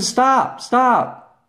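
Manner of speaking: high-pitched retold call to stop; mock call after someone leaving. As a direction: call out stop without raising the volume. Calling after a passing cyclist.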